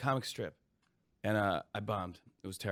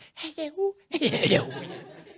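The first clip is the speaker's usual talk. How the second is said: high laughing squeal; Sandler-style mother yell lead-in. Retold or imitated. Sandler bit, the mother's goofy squeal before yelling. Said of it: "Adam Sandler going".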